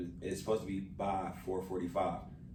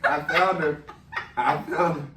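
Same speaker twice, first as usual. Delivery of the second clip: high, loud, laughing; laughing dog voice; 7 semitones higher, 11 dB louder than usual. Pretend. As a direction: squeal it through laughter. Playing the dog finding her.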